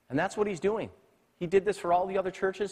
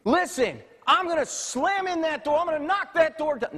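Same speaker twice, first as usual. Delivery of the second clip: mock angry threat; 11 semitones higher than usual. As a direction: bluster like a hothead about to break in. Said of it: "if this is me and I'm talking".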